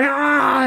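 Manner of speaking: high mock yelp; hammed-up cry of alarm; mock scream. Retelling the bathroom fight, high mock yelp.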